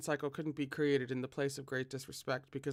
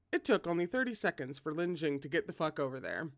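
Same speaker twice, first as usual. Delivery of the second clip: ordinary delivery, nothing marked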